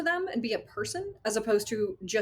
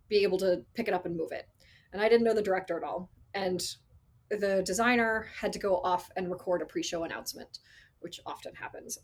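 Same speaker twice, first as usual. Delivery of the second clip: normal talking voice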